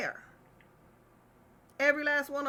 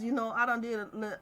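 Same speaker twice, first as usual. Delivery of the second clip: usual speaking voice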